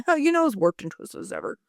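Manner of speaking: high-pitched chirpy imitation of dad; dad impression. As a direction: toss it off as dad's catchphrase. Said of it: "our dad would be like".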